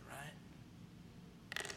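whispered, rising right; hushed aside to the congregation. Real, not acted. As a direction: breathe the question softly. Sermon aside.

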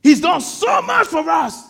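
high, loud burst of conviction; emphatic preaching shout. Real, not acted. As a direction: shout it to the congregation.

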